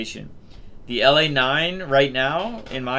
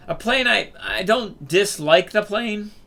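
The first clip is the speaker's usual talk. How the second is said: squeaky voice; 8 semitones higher, 5 dB louder, slower than usual. Real, not acted. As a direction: voice jumps up high and squeaky. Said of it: "My squeaky voice".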